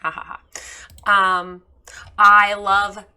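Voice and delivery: ordinary talk, nothing marked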